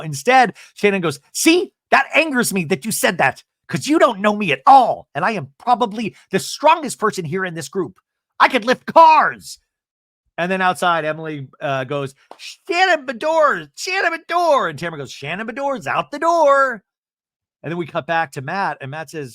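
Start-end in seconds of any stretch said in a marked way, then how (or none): 1.3-9.6 high, loud, rising indignation; hammed-up angry boast; mock indignant. Shannon bristling at Matt. 12.4-14.7 high drawn-out yelled name; hammed-up screaming of a full name; imitating a scream. Emily calling out outside. 15.1-16.8 sing-song taunt. Retold or imitated. Tamara's sing-song rhyme.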